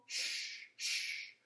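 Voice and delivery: soft unvoiced hush; hushing shh. Shown as a demonstration. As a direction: hush the snow so it doesn't fall. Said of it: "add the sh, sh in between".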